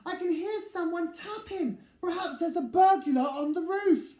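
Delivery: high, loud frightened small voice; a tiny mouse afraid of a burglar; scared little mouse. Acted.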